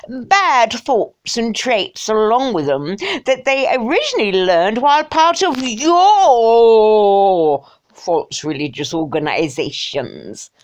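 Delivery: slow, hammed-up English toff, swelling loud on YOUR; mock upper-class English snob; snotty English. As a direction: drag it out in a snooty English accent. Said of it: "I think snotty English".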